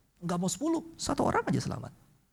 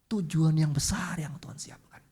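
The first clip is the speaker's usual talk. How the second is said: whispered emphasis. Acted. Preacher reminds the congregation of God's purpose, whispering. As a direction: whisper it like a secret truth.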